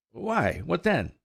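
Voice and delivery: high, slow, curious; ask, drawn in; eager inquiry. A narrator's reading. Colt asking old mare, curious and eager. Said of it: "inquired Firefly".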